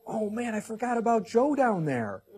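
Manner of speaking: a light parody of a forgetful God. Pretend. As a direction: play God as a scatterbrained boss who forgot. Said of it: "You think God's going".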